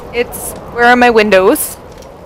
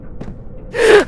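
sharp gasp; 9 semitones higher, 4 dB quieter than usual. Real, not acted. Sudden scare.

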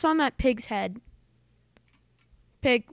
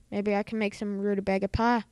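usual speaking voice

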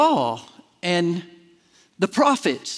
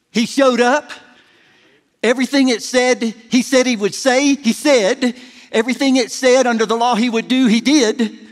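louder rhythmic pulpit run; fervent preacher building up; building preaching cadence; 3 dB louder than usual. Acted.

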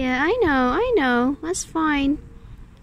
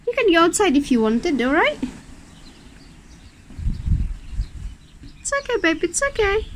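higher, coaxing pet voice; soothing a scared dog; 4 semitones higher than usual. Real, not acted. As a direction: coax a nervous pet gently.

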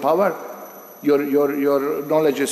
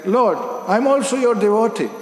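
higher, earnest plea to the Lord; pleading sage; 7 semitones higher than usual. Retold or imitated. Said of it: "Durvasa is declaring".

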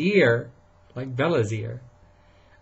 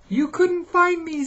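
very high, loud cub voice; Baby Bear teasing; 5 dB louder than usual. Acted. Baby Bear teases Papa, very high cub voice. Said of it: "said Baby Bear".

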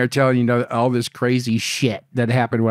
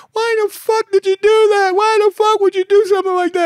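much higher, louder imitated scream; screaming crying girlfriend; 7 dB louder than usual. Girlfriend screaming at him after the rollover, high loud mock shriek. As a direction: mimic her shrieking in tears. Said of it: "she's screaming, and she's crying".

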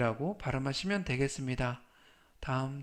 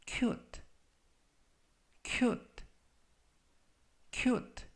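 "cute" three times, long pause after each; slow model reading; 9 semitones higher, much slower than usual. Shown as a demonstration. Teacher reviews vocabulary for followers, slow model reading. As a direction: read out "cute" slowly for learners to repeat. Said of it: "천천히 세 번씩 읽어드리고요".

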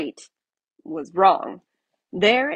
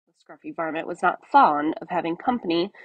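ordinary delivery

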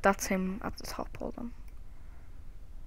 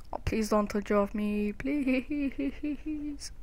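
scared pleading; 3 semitones higher, much slower than usual. Real, not acted. Pleading with ghost.

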